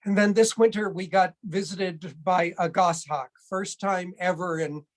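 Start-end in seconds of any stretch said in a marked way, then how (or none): none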